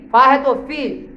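ordinary delivery

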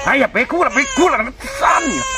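high, frantic scolding; exasperated. Acted. Kidnapper trying to silence a crying baby, exasperated.

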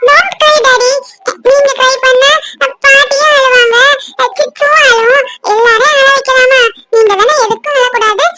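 put-on high, childlike chatter of a small daughter; little girl voice. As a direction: talk like a sweet little girl telling daddy secrets. Child comforts crying father.

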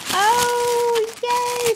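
high, loud delighted cheer. Real, not acted. Finding some bling she never had, delighted. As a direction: squeal happily at a surprise gift.

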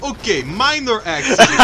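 a hint of thick Russian accent; Russian seller. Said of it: "he's like".

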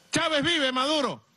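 high-pitched mock cheer; imitated shout. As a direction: mimic a passer-by calling to you. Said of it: "la gente me grita".

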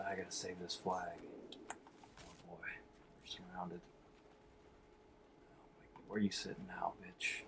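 soft, voiceless sneaking whisper; quiet whisper. Real, not acted. Gamer sneaks to save the flag, whispering to himself. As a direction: breathe it, staying hidden.

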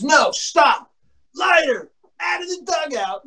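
high, called-out command; imitated order. Angry teammate summoning the pitcher from the dugout, imitated order. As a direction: order him out from a few yards off.

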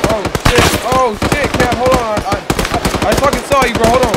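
loud alarmed yelling; panicked shouting. Real, not acted. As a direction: panic as shots hit. Panic under fire.

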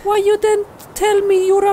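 high, fast put-on woman's voice; reproachful girlfriend. Alex scolding Steve, hurried. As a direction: rush the reproach.

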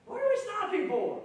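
high, indignant what are we stopping for; impatient complaint. Retold or imitated. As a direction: fuss at the holdup. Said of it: "I would have probably said".

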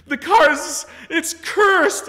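very high, loud mock wailing; the crying customer wailing to her salesman, in a very high hammed-up wail; hysterical wail. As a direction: wail like the car is doomed. Sobbing customer wailing over car.

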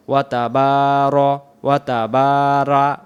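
ওয়া তাবারা with বা held one alif long; slow careful তাবারা; correct long a. Shown as a demonstration. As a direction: slowly hold the বা in তাবারা. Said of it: "এক আলিফ টান".

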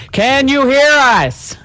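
high, slow, drawn-out call. Real, not acted. Checking whether the others heard them, calling out. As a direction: stretch it out to reach someone who may not hear.

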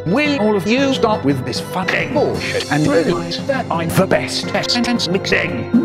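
usual speaking voice

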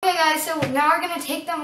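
speaking through a sheet mask; talking with face mask on. Real, not acted. Seen 0: Talking girl in stripes wears a wet sheet mask throughout.

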